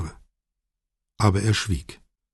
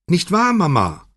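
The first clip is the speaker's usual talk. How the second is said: much higher, insistent, falling at the end; Chiara, more shrill; 10 semitones higher, 4 dB louder than usual. A narrator's reading. Daughter presses her mother to agree, shriller. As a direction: press the question in a shriller pitch. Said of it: "wiederholte Chiara etwas schriller".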